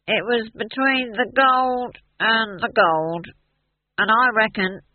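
put-on slow, high drunken drawl; drunk, concentrating. A narrator's reading. Drunk dwarf considering the question, slow and slurred. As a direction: drag it out, concentrating hard through the drink.

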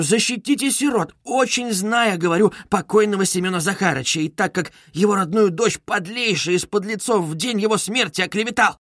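high, indignant gentlewoman pleading her case; Katerina Ivanovna. Acted. Widow complaining to a gentleman, lofty and indignant. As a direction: plead grandly, with rising indignation.

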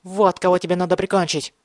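high, frantic madman's voice; crazed outburst. A narrator's reading. Mad boy turns on Thomas, crazed high outburst. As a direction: spit it out as a raving accusation. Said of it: "в голосе явственно звучало безумие".

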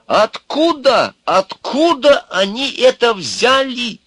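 very high, loud, drawn-out outcry; ranting outrage. A narrator's reading. Sage raging about his office, outraged. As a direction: demand it as if tearing your hair out. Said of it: "рвал и метал".

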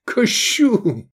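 high, choked mock accusation; gurgling with laughter. A narrator's reading. Friend calling him blasphemer, gurgling with laughter. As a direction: squeak it out, weak from laughing. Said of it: "пробулькал Искандер в изнеможении".

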